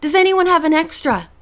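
put-on boy hollering from another boat; hollered cry. A narrator's reading. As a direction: shout across the waves for help. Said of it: "Aaron hollered".

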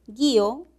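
Guío, the í stressed, split gu-í-o; guío with hiato. Shown as a demonstration. Teacher showing students Guío, stressed í. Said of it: "es un verbo".

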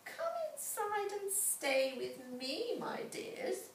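high, sugary witch's invitation; sweet old woman. A narrator's reading. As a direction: lure the children in with false kindness. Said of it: "she smiled".

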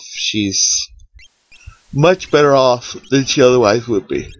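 talking through a yawn; words stretched by a yawn. Real, not acted. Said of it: "I'm sorry about the yawning".

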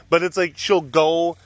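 usual speaking voice

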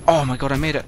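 usual speaking voice

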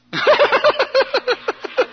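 high stuttering giggle that tapers off; imitated stuttering laugh. Storyteller mimicking his friend getting blessed, high stuttering giggle. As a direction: imitate a man bubbling over.

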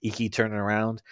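usual speaking voice

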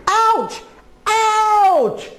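two high acted yelps, the second longer; pained cries. Goat bitten again and again, high acted cries of pain. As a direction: yelp high at each bite. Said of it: "cried Goat".

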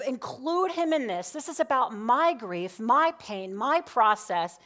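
a grieving daughter's outburst, refusing; temper tantrum. Retold or imitated. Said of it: "my little temper tantrum".

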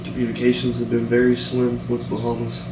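ordinary talk, nothing marked

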